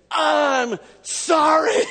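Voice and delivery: high, slow, stretched-out angry yell broken by a laugh; mock angry scream. Girl forced to apologize, screaming a drawn-out sorry. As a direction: scream a grudging apology like a furious child. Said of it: "screamed as loud ... as angry as she could".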